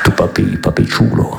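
low reggaeton murmur; reggaeton Vader. Pretend. Darth Vader crooning reggaeton in a low voice. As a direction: slide into a reggaeton hook. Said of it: "dans la langue du reggaeton".

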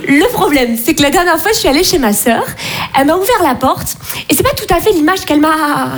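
ordinary talk

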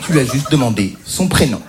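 ordinary talk

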